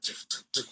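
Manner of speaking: voiceless, soft "tuk" shot noises; imitated gunshots. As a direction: spit out little mouth-made gunshots. Imitates gunfire while sliding.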